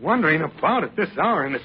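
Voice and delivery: high, halting, worked-up reproach; agitated scolding. Acted. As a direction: scold her in fretful bursts. Worried husband scolds wife.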